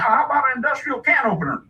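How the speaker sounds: high, very quick offer; haggling hillbilly father. Retold or imitated. Dad tries offering a can opener, hillbilly haggling. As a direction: pitch it hopefully like a rube.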